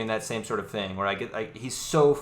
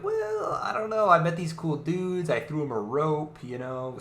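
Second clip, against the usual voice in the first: high, slow, casual shrug; playing Moash, pitched way up; high mock Moash voice; 6 semitones higher than usual. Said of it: "And Moash is like".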